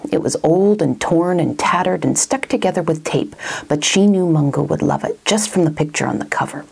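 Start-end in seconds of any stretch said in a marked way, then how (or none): none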